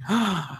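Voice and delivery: acted intake of breath; imitated shocked gasp. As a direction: gasp like an awestruck protester. Imitates female protester's shocked reaction.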